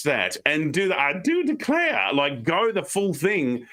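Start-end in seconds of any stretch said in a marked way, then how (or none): 1.0-2.1 high, slow Southern Belle "I do declare"; sweet Southern Belle put-on, slow; a light Southern Belle impression; Southern Belle drawl. Shown as a demonstration. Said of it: "if you're a Southern Belle".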